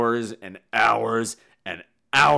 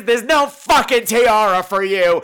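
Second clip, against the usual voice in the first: ordinary talk, nothing marked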